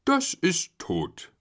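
slow, slightly put-on reply; drawn-out answer, lightly in character; Schnuffel answering. A narrator's reading. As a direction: state it slowly as the parent. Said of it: "antwortete Schnuffel".

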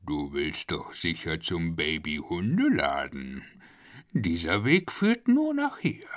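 low, menacing crocodile voice; a lightly voiced low gravel growl; deep crocodile voice. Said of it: "Es sagte mit tiefer Stimme".

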